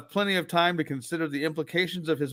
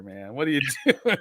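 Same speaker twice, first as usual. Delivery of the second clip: ordinary talk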